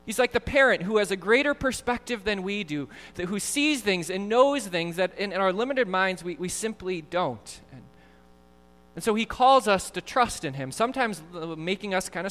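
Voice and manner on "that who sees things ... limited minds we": normal talking voice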